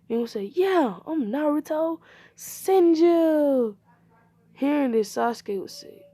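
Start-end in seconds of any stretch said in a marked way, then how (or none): 0.6-3.8 high, loud, drawn-out self-introduction; proud announcement. Acted. Boy introduces himself to the teacher, proud announcement. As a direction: proclaim the name, stretching it out.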